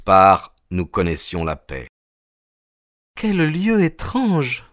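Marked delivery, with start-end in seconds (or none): 3.2-4.6 sighing. Acted. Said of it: "soupira-t-elle".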